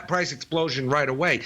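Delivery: ordinary delivery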